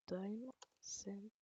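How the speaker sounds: normal talking voice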